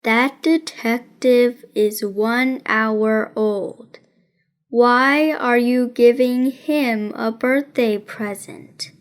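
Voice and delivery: a hint of a dry boy detective, taking his time; deadpan detective Nate. Nate questions Rosamond about the present, slow and deadpan. Nate voiced in read story.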